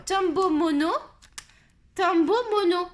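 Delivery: loud, insistent brand name; indignant exclamation. Real, not acted. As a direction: hammer it out loudly as proof. Martèle la marque, preuve de l'erreur. (marteler bien fort, avec indignation)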